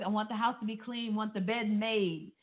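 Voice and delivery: ordinary talk, nothing marked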